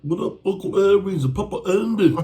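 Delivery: high, loud hammed-up rapper greeting. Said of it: "That's some [name] shit".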